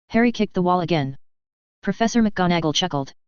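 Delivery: ordinary delivery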